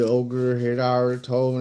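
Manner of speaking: normal talking voice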